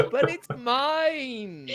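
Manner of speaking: high, drawn-out playful whine; mock childish protest. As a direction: whine it like a child refusing to share. Mock protest after being told to share.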